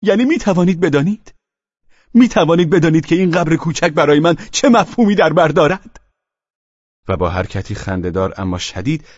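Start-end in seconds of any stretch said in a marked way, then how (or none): 0.0-6.0 high loud anguished cry; grieving father shouting at his rival. A narrator's reading. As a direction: cry it out, wounded. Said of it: "فریاد کشید".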